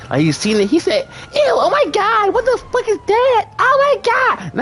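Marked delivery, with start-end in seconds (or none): 1.3-4.5 high, squealed disgust; Bruce shrieking. Retold or imitated. The friend Bruce sees it and shrieks in disgust, high squeal. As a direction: shriek like you saw something gross.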